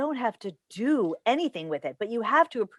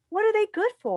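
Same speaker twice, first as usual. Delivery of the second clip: pleading demand; 11 semitones higher, 3 dB louder, faster than usual. Acted.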